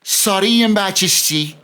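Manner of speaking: lightly voiced drunk Scouser; a hint of a tipsy bloke bowing to the Queen; mock drunk mumble of an apology. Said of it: "He goes".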